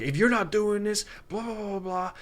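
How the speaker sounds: barking high drill sergeant orders. Retold or imitated. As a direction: bark it like a drill instructor. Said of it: "he sounds like a drill sergeant".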